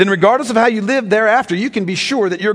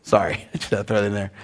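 quick, quiet laughing apology; laughing aside; 12 semitones lower, 8 dB quieter, much faster than usual. Real, not acted. Preacher apologises to congregation, laughing softly. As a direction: chuckle it off and move on.

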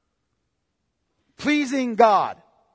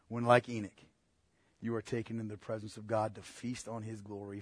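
quiet, tender sermon passage; hushed preaching; 10 semitones lower, 10 dB quieter than usual. Acted. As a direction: lower the voice to a near-hush over the promise of heaven.

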